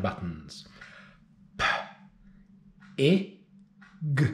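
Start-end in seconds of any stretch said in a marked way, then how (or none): 1.6-4.3 P, I, G said as three separate sounds; sounding out words. Shown as a demonstration. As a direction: segment P, I, G with pauses. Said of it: "I'm gonna sound out the word".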